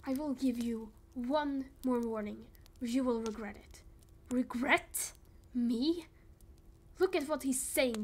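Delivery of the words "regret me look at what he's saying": mocking scorn. Mocking a threat.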